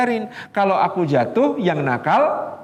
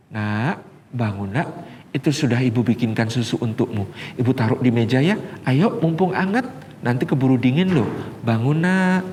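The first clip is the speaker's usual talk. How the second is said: gentle second mother; 7 semitones lower than usual. Retold or imitated. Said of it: "Ibu kedua membangunkan dengan cara mengatakan".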